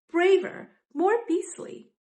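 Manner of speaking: ordinary delivery, nothing marked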